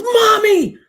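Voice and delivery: a high, loud call up the stairs. Retold or imitated. Daughter calling her mother from the banister, a loud high yell. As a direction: yell for mom, urgent.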